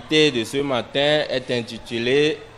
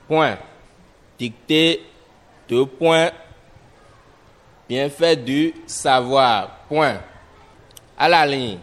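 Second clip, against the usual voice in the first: dictation; 3 semitones higher, 4 dB louder than usual. Shown as a demonstration. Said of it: "nous allons passer à l'écriture de la dictée".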